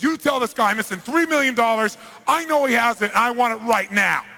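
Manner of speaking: loud, high angry demand; freaking-out mafia boss. Acted. Mafia boss demanding his missing money through an interpreter, angry and loud. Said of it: "the mafia boss freaks out".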